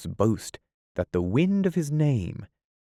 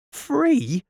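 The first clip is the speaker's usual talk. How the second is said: high, louder disbelieving squeak; shocked echo of the word; astonished; 5 dB louder than usual. Acted. A pirate astonished by the captain's order.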